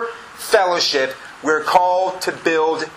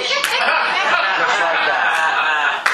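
laughing; much slower than usual. Real, not acted. Laughing over the man's sudden change.

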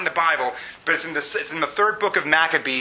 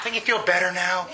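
usual speaking voice

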